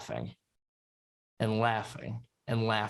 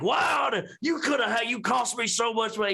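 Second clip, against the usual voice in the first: furious shout; 10 semitones higher than usual. Acted. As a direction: yell at the man who cost you. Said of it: "he's like".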